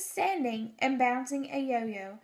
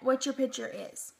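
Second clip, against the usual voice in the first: ordinary delivery, nothing marked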